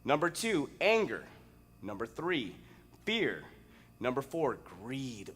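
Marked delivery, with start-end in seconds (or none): none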